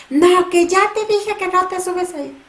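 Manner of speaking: high, loud parental scold; scolding yell. Retold or imitated. Parent yelling at child on the stairs, high loud scold. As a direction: bark a warning at a climbing toddler. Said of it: "la respuesta inmediata a gritar".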